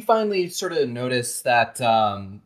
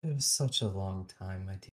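hushed reply; 5 semitones lower, 7 dB quieter, faster than usual. Acted. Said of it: "she's just like".

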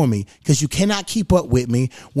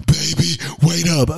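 mock gasping for air; winded hiker struggling to catch up, hammed-up; out of breath. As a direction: pant heavily, then plead between breaths. Acts fat partner trailing up mountain.

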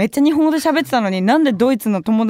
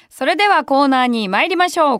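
ordinary delivery

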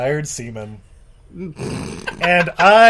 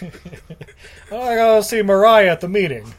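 high, loud celebrity impression; hammy star voice; 4 semitones higher, 5 dB louder than usual. Star excuses himself for a meeting, hammy.

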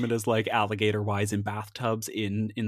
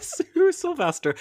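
laughing; 4 dB louder, slower than usual. Real, not acted. Laughing repeat of name.

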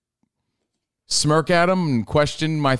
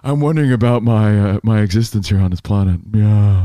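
lower, louder musing voice; deep cat voice; 6 semitones lower, 4 dB louder than usual. Retold or imitated. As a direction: ponder it like a sage cat. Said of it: "It wasn't telling me to".

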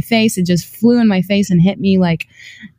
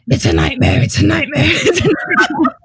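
demon voice; 6 semitones higher than usual. Retold or imitated. Waking up beside my boyfriend in the night, demon voice. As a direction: chant it like a demon, pitch breaking upward. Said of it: "this demon voice".

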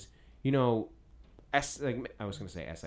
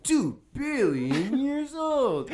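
very slow, much higher, laughing through it; Bill Nye impression; 13 semitones higher, 5 dB louder, much slower than usual. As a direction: stretch it out and crack up. Imitation again, laughing.

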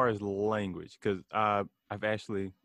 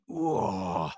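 a drawn-out imitated groan, pitched higher; mock gym moan; 7 semitones higher than usual. Retelling a gym guy's moan to a friend, mock groan. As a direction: mimic the guy moaning mid-lift. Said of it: "He moans like it's a porno".

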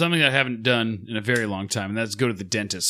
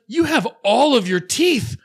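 much higher, louder, gushing dentist impression; mock gushing dentist; 11 semitones higher, 5 dB louder than usual. Dentist gushing over the patient's teeth, high mock voice. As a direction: coo it like an envious dentist.

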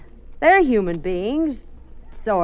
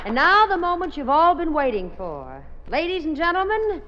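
grand entrance announcement; 8 semitones higher than usual. Acted. MC introduces the star on stage.

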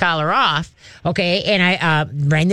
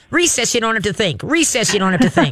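excited dog voice; 5 semitones higher than usual. Retold or imitated. Dog's view of recess.

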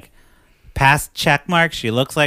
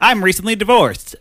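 mom voice; 9 semitones higher, 6 dB louder than usual. Retold or imitated. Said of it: "her mom".

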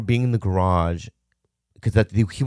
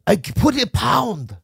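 high, loud, slow broken-English dad voice; hammed-up curt father with an accent; imitating dad; 14 semitones higher, 4 dB louder, slower than usual. Voicing his dad in story.

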